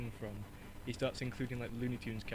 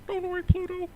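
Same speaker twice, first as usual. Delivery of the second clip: loud, very high falsetto mouse; high, forceful mouse impression; Mickey Mouse; 8 dB louder than usual. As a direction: reassure in a squeaky cartoon voice. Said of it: "do the Mickey Mouse impression".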